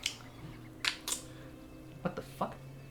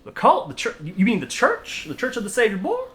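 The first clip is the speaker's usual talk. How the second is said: shocked; 9 semitones higher than usual. Acted. Eldest son hearing his church called a cult, shocked. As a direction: recoil at the word cult.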